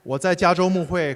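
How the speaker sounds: normal talking voice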